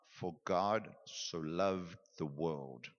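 low, slow, lingering over each word; slow scripture reading. Shown as a demonstration. Teacher showing students how to read scripture slowly, low and unhurried. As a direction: read the verse slowly, letting it sink in. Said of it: "So maybe I read something like".

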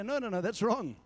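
a gentle, caring no; gently. Shown as a demonstration. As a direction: correct them kindly, no edge. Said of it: "So I do it gently".

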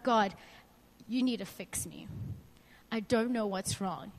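low, quiet plea to God; subdued, understated desperation; desperate prayer. Retold or imitated. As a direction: beg softly, with pauses. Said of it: "I was so desperate".

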